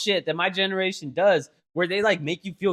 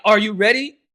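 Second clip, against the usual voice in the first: louder, bright pumped-up question; encouraging hype; 6 semitones higher, 9 dB louder than usual. Retold or imitated. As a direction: hype her up to record. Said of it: "Encouragingly, not aggressively".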